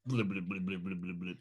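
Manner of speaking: bubbling blub noises; gloopy bubbling slime voice. Acted. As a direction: gurgle like slime underwater. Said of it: "You're doing your blub, blub".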